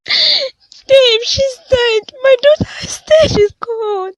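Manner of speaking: high, loud sobbing cry; sobbing grief. Acted. Mother tells husband their daughter died, sobbing. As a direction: break down in tears, wailing the news. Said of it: "Please stop crying already".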